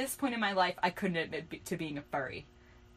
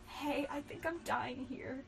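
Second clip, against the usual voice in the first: soft, slow, raised-pitch dying plea; hushed and slow, as if fading away; whispered; 6 semitones higher, 8 dB quieter, slower than usual. A narrator's reading. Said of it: "whispered Riku".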